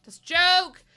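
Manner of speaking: loud shout. Real, not acted. Streamer yelling to chat that it's only a joke, loud. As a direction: yell "That's a joke" to make clear it's a joke.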